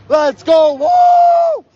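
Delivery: high, loud shout of joy; a real burst of celebration, then a whoop; joyful shout. Real, not acted. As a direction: yell it out in triumph. Overjoyed at donations, shouting.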